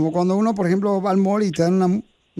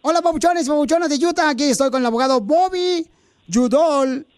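higher, upbeat radio spot; ad read; 5 semitones higher than usual. Acted. Host recording the lawyer's commercial, upbeat. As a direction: sell the lawyer to the audience.

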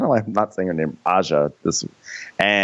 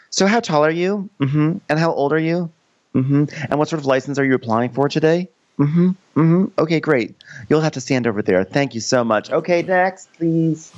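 high, brisk mock-official interviewer; pitched-up bureaucratic politeness; DMV clerk; 9 semitones higher than usual. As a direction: process them like a clerk with a queue. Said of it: "acting like she is at the D M V".

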